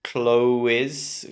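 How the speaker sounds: "clothes" split as CLOH-iz; students' wrong clothes. Retold or imitated. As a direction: imitate the mistake, "clothes" as clo-wiz. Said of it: "They say something like".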